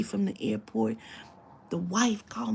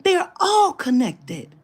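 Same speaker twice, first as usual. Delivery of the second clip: slow, much louder, much higher wail of frustration; exasperated cry; 8 semitones higher, 11 dB louder, slower than usual. Real, not acted. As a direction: cry it out, fed up. Frustrated outburst while telling story.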